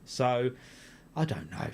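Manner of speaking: ordinary delivery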